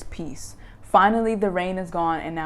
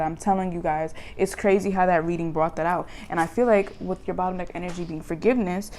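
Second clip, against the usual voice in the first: ordinary talk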